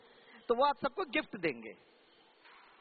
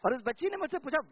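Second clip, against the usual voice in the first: usual speaking voice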